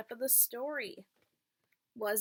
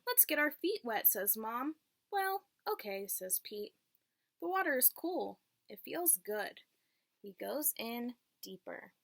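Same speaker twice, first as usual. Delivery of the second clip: clear, lilting read-aloud voice for a class; teacher reading a picture book to the class; reading to children. Acted. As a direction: read it like storytime for little kids.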